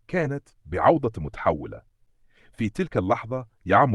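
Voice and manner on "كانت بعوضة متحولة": plain reading voice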